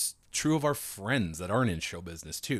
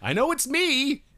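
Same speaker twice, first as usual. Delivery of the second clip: high, loud joking heckler confession; mock heckler; 7 dB louder, much slower than usual. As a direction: play along as the guilty audience member. Joins the mock comic bit.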